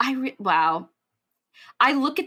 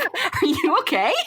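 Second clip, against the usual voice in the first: laughing mock concern; 8 semitones higher than usual. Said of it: "looking at me like".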